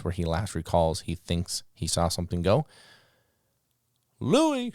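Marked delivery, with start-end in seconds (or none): 4.2-4.7 high, loud call of the name across the field; ringing field call to a dog. Retold or imitated. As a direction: sing out the dog's name to send him. Handler calling dog by name.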